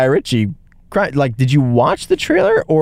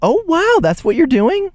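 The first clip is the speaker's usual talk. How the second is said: put-on very high, gasping old-lady wonder; shocked grandma. Imitating a shocked old woman at the movies. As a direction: gasp it out like a scandalised grandma. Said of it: "everybody's grandma who was just like".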